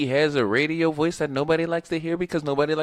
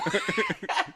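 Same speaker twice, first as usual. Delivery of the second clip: high squeal of laughter; 7 dB quieter than usual. Real, not acted.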